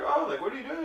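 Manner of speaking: high, sweet imitation of an old lady. The old lady asking what he's doing, high sweet voice. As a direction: put on a lilting old-lady voice. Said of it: "she was just like".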